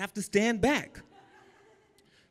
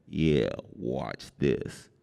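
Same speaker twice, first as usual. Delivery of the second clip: much lower, slow and calm; a big man rumbling confidently; deep slow giant; 12 semitones lower, 3 dB quieter, much slower than usual. Retold or imitated. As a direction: giant cellmate calmly promising to handle it.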